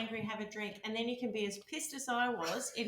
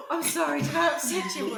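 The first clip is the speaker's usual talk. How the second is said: sarcastic mock apology, louder and higher; teasing fake concern; 7 semitones higher, 8 dB louder than usual.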